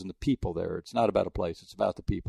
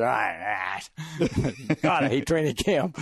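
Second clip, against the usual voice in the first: put-on McClain accent; 3 semitones higher than usual. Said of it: "I can't do the McLean accent".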